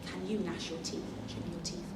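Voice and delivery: usual speaking voice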